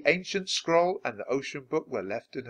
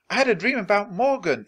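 exclaiming; 8 semitones higher than usual. Acted. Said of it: "exclaimed Annie".

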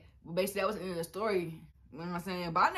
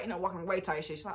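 normal talking voice

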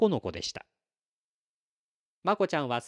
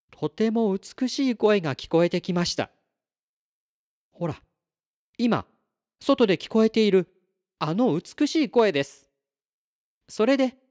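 高めで力のこもった子どもの語り; 星の子として、見てきたことを一生懸命伝える; 思いついて語る星の子; 5 semitones higher, 4 dB louder than usual. Acted. 星の子が思いついて語りだす. (星の子キラスが身を乗り出すように語る声)

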